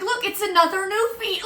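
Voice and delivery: high, loud fake enthusiasm; mock excitement. Telling how she fibbed as mom walked over, mock-excited. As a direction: exclaim it high and loud, hamming it up.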